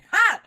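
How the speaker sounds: high loud cry of joy; joyful shout. Real, not acted. Praising God after the fax came, a joyful shout. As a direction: let out a triumphant shout.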